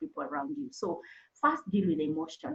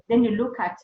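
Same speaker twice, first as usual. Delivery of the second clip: ordinary delivery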